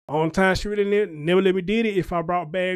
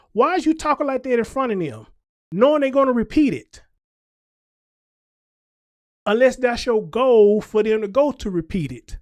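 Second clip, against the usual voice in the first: higher, pointed reproach; scolding challenge; 4 semitones higher, 4 dB louder than usual. Real, not acted. Scolding young mothers who talk badly around their kids. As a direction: call them out to their face.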